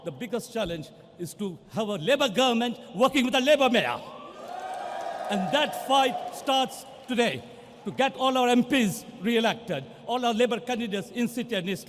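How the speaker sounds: loud, rousing call to the crowd; rallying shout. Real, not acted. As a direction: shout the fight to the supporters. Rallying the party faithful.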